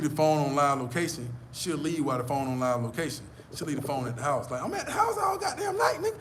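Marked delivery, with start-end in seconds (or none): 4.6-6.2 high, exaggerated mock girlfriend voice. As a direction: mimic her insisting she was home all night. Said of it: "She kept emphasizing on that".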